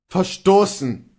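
loud, slow outraged repetition; outraged cry. A narrator's reading. Luther repeating Kohlhaas' word, loud and slow. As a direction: throw the word back at him. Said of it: "rief Luther".

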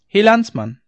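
high, loud hail to a stranger; calling out. A narrator's reading. Rider hailing a fellow countryman, calling out. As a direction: call out to hail someone on the road. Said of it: "rief ich".

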